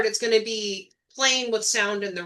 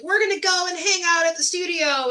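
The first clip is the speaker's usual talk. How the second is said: higher-pitched lightly mocking quote; mock studio hangers-on; 7 semitones higher than usual. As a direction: imitate people announcing a studio hangout. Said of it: "all these people that are like".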